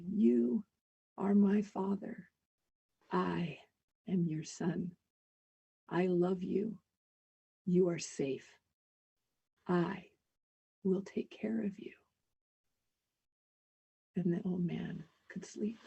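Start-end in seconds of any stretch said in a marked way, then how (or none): 0.1-11.9 slow, soft, steady comfort fading to a whisper; reassuring. Acted. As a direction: soothe him gently into sleep. Son calming frightened father.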